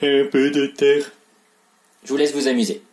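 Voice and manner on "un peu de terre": loud sneering villain. Shown as a demonstration. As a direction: boom it like a villain. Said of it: "une voix de méchant".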